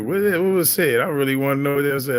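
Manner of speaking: high, loud, quick what; surprised curiosity. Real, not acted. Streamer curious about a hidden message, surprised and quick. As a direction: jump up in surprise and rush it.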